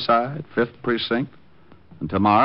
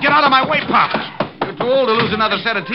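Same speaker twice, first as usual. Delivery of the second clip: loud, high, fast threat; forceful shout; 5 dB louder, faster than usual. Acted. Detective shoving the old bartender aside, loud and fast. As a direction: shove past him shouting.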